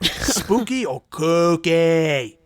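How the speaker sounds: slow, drawn-out Boston accent. Shown as a demonstration. Asking the friend in a Boston accent. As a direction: stretch it out like a Bostonian. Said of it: "that's my Boston accent".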